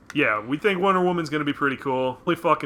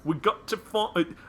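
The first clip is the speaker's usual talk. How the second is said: Michael Caine voice. Retold or imitated. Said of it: "that was very Michael Caine".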